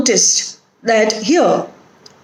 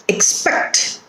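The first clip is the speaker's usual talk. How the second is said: expect with weight on PECT; weak ex, strong pect in expect; stress on second syllable; 4 dB quieter than usual. Shown as a demonstration. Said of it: "put the stress on the root word".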